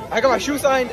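ordinary talk, nothing marked

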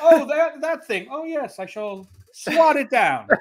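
high, hammy posh Englishman, laughing; slow pompous British gent. Pretend. Posh player voice.

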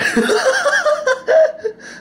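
high burst of laughter; triumphant laugh. Real, not acted. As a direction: laugh at the prank. Pranking friend into saying dumbass.

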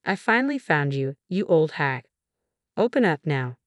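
ordinary delivery

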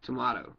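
tomato said tuh-MAH-toh; broad A pronunciation. Shown as a demonstration. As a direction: say tomato with an "ah" in the middle. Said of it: "nobody says tomato".

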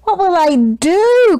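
high, rising wail of dismay; dismayed cry. A narrator's reading. Baker whose milk is spilled, high dismayed cry. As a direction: cry out in a panic, pitch climbing. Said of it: "cried Walter".

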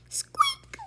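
very high squeak; squeak imitation. Squirrel mimicking the creature, high.

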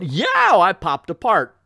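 high, loud comic yow; hammed-up cartoon shock; startled yelp. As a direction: yelp it, then ease down. Acts hero startled at popping apart.

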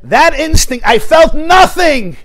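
high, loud and slow, hammering it home; forceful declaration. Retold or imitated. Rebbe declaring to the informer what he felt, in a loud, slow shout. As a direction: shout it so the whole room hears.

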